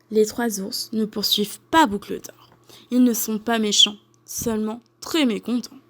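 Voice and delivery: ordinary delivery, nothing marked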